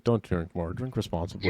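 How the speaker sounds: low, dry announcer disclaimer; deadpan legal disclaimer. Acted. Mock disclaimer read.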